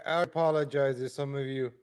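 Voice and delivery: ordinary delivery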